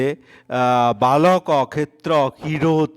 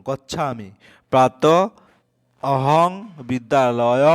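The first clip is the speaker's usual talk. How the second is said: usual speaking voice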